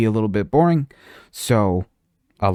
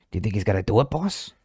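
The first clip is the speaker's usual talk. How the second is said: lightly voiced goblin, rising on boss; a hint of a timid goblin asking; 5 dB quieter than usual. Goblin asks his boss, lightly voiced. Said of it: "That's gonna be his voice".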